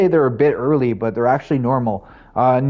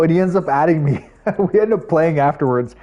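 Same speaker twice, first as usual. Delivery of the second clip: normal talking voice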